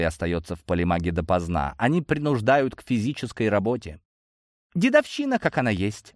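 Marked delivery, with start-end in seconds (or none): 4.7-6.1 wry amusement. A narrator's reading. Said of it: "усмехнулся фамильяр".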